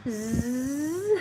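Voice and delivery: drawn-out zzz; long dragged buzz. Shown as a demonstration. Learner imitating dragged words with a long buzz. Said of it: "Almost dragging the words".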